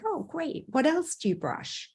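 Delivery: high, bright-eyed praise then prompt; sing-song teacher prompt to a child; mock delighted teacher. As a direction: cheer the kid on, then ask again.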